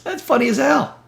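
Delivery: breathy laugh into a high, amused comment. Real, not acted. Laughing at a Facebook meme he read out. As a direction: laugh it off, still high from the joke.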